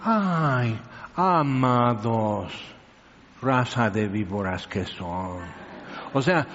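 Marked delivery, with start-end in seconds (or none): 0.0-5.4 lower, very slow, sugary mock tenderness; mock sweet rebuke. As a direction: sweeten the insult, drawn out. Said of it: "así te estoy cuidando el tono".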